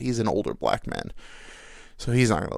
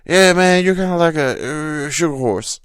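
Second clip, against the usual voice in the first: loud, higher imitation of the coworker; 7 semitones higher, 9 dB louder than usual. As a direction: boom it out like the new guy. Coworker voiced in story.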